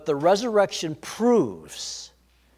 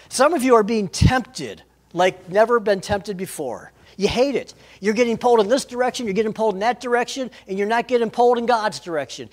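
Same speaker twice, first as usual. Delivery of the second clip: louder, driving sermon delivery; full preaching; 4 semitones higher, 5 dB louder than usual. Acted. Pastor urging tempted listeners, louder and driving. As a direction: preach it with rising urgency.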